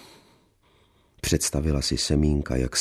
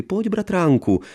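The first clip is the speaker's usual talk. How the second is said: much higher, sweetly faux-intimate; coy cousinly invitation; mock confiding. A narrator's reading. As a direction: play the affectionate cousin. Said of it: "jakoby důvěrně".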